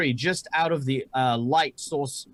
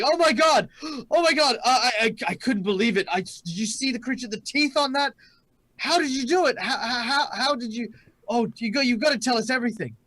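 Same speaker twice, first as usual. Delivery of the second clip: high-pitched, breathless gushing of starstruck fans; energetic fans; 8 semitones higher than usual. Acted. Excited sweepers pestering their heroes for the story, energetic and high. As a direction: stumble over the words in high, eager excitement. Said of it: "they're so energetic".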